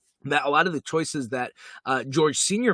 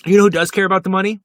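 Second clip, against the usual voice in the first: excited; 6 semitones higher, 10 dB louder, much faster than usual. Real, not acted. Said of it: "excited lead-in".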